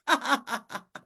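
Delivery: high sly cackling laugh; sly cackle. Acted. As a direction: cackle like a trickster spider. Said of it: "he laughed".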